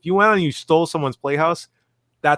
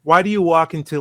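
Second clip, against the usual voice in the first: ordinary talk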